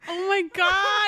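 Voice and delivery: high, loud, slow shout of joy; overjoyed reaction to the story's ending; delighted shriek. Real, not acted. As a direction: scream it with delight. Shrieking delight after story ends.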